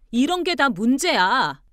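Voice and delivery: loud, high, drawn-out conclusion; pent-up indignation. Acted. Wife capping three years of grievances, indignant. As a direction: drive it home loudly and slowly.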